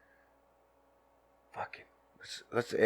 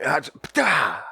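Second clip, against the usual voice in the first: kick sound effect; 6 semitones higher, slower than usual. Retold or imitated. Kicking the snot-nosed kid.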